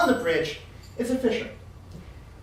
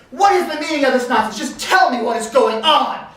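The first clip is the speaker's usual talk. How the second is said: loud, raised, authoritative demand; commanding anger; 5 semitones higher, 8 dB louder than usual. Acted. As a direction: thunder at them like a chief. Towering chief demands an explanation.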